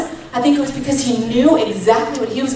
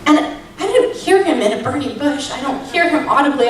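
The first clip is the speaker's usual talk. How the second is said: ordinary talk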